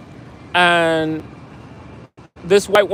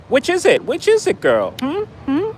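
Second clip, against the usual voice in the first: much higher, sassy mock demand; sassy challenge; 10 semitones higher than usual. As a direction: toss the question at her with high attitude.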